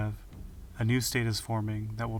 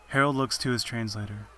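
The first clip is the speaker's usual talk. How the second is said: plain reading voice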